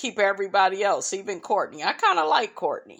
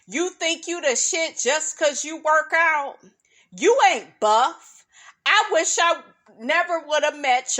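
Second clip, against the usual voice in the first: quoting the breakup rant; 4 semitones higher than usual. Retold or imitated. Reciting Aisha's rant at her man, sassy and rising. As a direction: tear him down line by line. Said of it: "she said the part".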